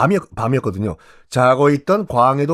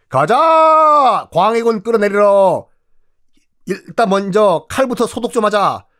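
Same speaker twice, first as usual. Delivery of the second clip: rallying cry; 5 semitones higher than usual. Acted. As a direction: rouse the conspirators, shouting. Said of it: "반정 세력들이".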